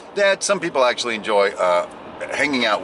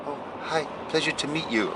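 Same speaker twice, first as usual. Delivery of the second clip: much softer, timid polite hello; meek greeting; 2 semitones higher, 9 dB quieter than usual. Retold or imitated. Boss mimics the shy buddy meeting someone, softly. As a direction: imitate the shy buddy, barely voiced. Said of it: "like that".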